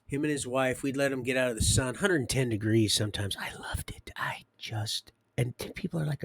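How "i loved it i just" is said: soft breathy whisper of delight; whispered fondly. Real, not acted. Remembering the desert heat, a fond whisper. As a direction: whisper it like a treasured memory.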